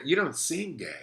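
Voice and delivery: high, slow mock of a condescending stranger; mock clueless remark. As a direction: play the patronising acquaintance, drawn out. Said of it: "so somebody says".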